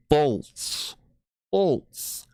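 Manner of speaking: exaggerated high "Bolts", each sound crisp; over enunciating. Shown as a demonstration. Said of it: "having a tough time saying".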